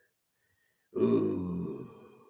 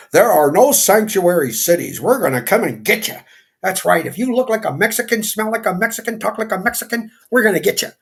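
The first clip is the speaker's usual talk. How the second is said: loud, higher, taunting official voice; mock ICE official; 7 semitones higher, 7 dB louder than usual. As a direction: bark it like a gloating enforcer on TV. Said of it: "ice guy come out on national T V".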